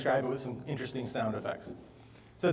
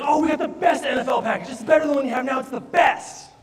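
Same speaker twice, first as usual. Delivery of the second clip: high, loud, beaming sales pitch; overly enthusiastic salesman; 9 semitones higher, 4 dB louder than usual. Retold or imitated. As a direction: pump every word full of eager cheer. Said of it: "He's so enthusiastic".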